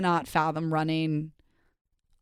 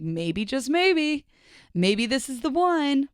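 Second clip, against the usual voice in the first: higher, slow, dreamy hope; hopeful longing; 6 semitones higher, slower than usual. Retold or imitated. Hoping an unknown text is a crush, slow wistful hope. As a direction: stretch it out like a wish coming true.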